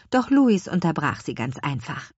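ordinary delivery

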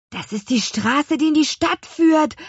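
excitement climbing in pitch and volume; excited call. A narrator's reading. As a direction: call it out thrilled, building to the end. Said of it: "rief Louis aufgeregt". (aufgeregt gerufen)

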